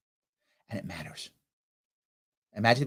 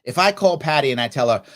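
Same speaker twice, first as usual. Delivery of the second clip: ordinary talk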